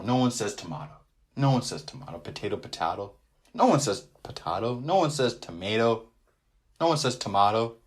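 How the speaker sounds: "tomato" said to-MAH-to with a broad ah. Shown as a demonstration. Host insisting nobody says to-MAH-to. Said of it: "Like tomato, tomato".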